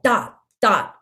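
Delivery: "Dot, dot" as two fat separate hits; marcato articulation. Shown as a demonstration. Teacher showing target-ball notes to the band, "Dot, dot" full and detached. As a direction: give each "dot" a body and a stopped end. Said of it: "Think of that target ball".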